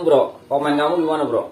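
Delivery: ordinary talk, nothing marked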